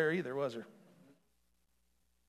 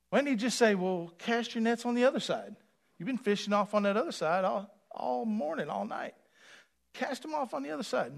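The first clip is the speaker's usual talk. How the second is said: higher preacher cadence; preaching at full force; 8 semitones higher, 5 dB louder than usual. Acted. Preacher questioning Jesus's words, higher and insistent. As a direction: press the question on the pews.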